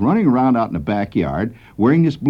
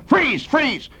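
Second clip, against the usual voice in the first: much higher cop yell of freeze; imitating a holler. Kid whirling round and yelling at the narrator, mock cop holler. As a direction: yell it like a cop drawing on you. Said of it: "he turned on me and he said".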